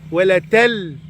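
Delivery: normal talking voice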